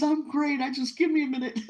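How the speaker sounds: high, loud, emotional retelling breaking into laughter; retold bawling. To the little old lady on the phone, retold bawling. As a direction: imitate bawling while insisting you're fine. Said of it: "and I'm bawling".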